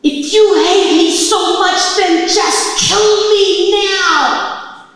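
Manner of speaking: anguished scream at the heavens, stretched out; full-voiced scream. Woman screaming up at the heavens, desperate scream. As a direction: scream it high and slow, every word drawn out. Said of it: "I screamed in my head".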